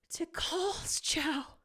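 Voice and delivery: slow breathless whisper; whispered realization; awed whisper. A narrator's reading. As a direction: hush it out in wonder. Realizes who it is.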